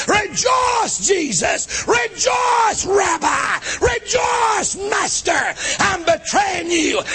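mocking Judas. Judas mocking Jesus while kissing him, high sneering calls. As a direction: taunt him with every rejoice.

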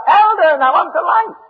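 high-pitched mock yell of a little boy; imitated child's yell. Little boy calling his father for the light, mock yelling. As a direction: call out high like a child in the night. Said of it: "little boy yells out".

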